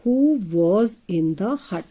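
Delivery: drawn-out question; teacher modelling a question; very slow model question. Shown as a demonstration. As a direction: read the question slowly word by word. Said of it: "sound किसकी है".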